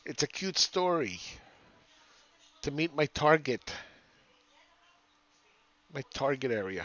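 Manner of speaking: mock a chatty little old lady; old lady accent like a West Palm Beach retiree. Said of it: "That's an old lady accent".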